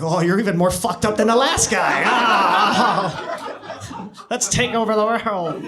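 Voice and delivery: loud, cackling mock-evil delight; gleeful devil. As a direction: crow with wicked glee, building to a shout. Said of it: "they're like".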